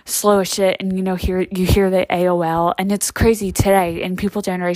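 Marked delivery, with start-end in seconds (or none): none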